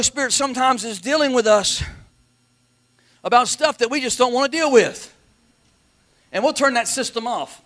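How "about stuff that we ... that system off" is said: ordinary talk, nothing marked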